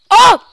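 high, very loud outcry; shocked yell. Real, not acted. Player who just hit the wrong key, shocked yell. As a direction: yell out at a sudden mishap. Game mishap, cries out.

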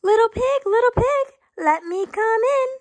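singsong storybook wolf, high and wheedling; grinning wolf. A narrator's reading. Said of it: "said the wolf, grinning".

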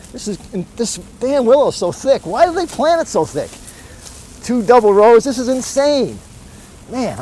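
high-pitched, frantic rant about being late; frantic panic. Acted.

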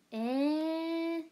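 long, loud, high "eh"; real drawn-out cry of disbelief; surprised outcry. Real, not acted. Reacting in surprise to a comment.

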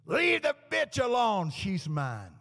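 loud, high, drawn-out possessive yell; demonic outburst. Retold or imitated. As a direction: imitate a devil claiming its victim. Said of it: "out of her voice came this".

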